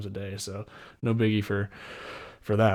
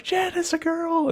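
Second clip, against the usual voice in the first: ecstatic announcement. Retold or imitated. New dad announcing the girl to Jen, much higher and overjoyed. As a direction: shout it out, overjoyed.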